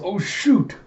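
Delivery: high-pitched dismayed exclamation; acted alarm. Alita spotting eyes in the tunnel, acted alarm. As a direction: let out a mock panicked yelp.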